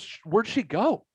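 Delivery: high, slow, puzzled question; retold bafflement. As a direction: imitate a baffled son, drawing it out. Son baffled by her vanishing.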